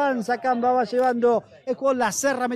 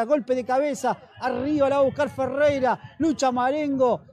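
ordinary talk

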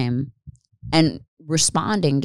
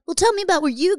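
mock-polite date voice; 12 semitones higher, faster than usual. Retold self on a date.

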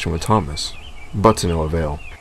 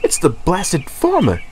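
higher, cross complaint; mild fuming Henry; 10 semitones higher than usual. A narrator's reading. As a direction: blame the farmer. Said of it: "fumed Henry".

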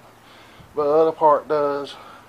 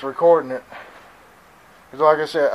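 ordinary talk, nothing marked

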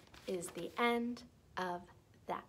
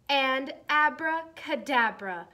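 abracadabra drawn out big and loud; magic word; 7 semitones higher, 11 dB louder, slower than usual. Acted. Teacher casting the spell in the story, slow and loud. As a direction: cast the spell with a slow flourish.

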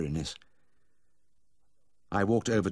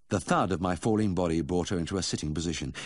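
plain reading voice

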